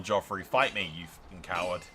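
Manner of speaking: ordinary talk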